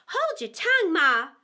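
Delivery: put-on loud, curt young snap; snappish. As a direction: cut her mother off. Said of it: "a little snappishly".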